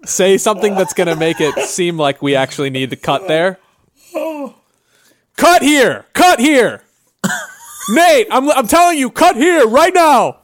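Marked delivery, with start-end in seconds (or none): 5.4-10.4 calling out. Real, not acted. Calls out to the editor.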